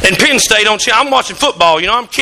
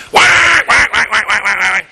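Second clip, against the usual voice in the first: imitating duck call; 4 semitones lower than usual. Pastor imitating son blowing duck calls on the porch, mock call. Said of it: "Hunter's going".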